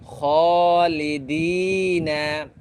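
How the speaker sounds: খালিদিনা with the long vowel drawn out one alif; elongated madd. Shown as a demonstration. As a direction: stretch খালিদিনা slowly. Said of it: "মদগুলা কীভাবে এক আলিফ লম্বা করে পড়তেছি". (খালিদিনা মদ টেনে লম্বা করে)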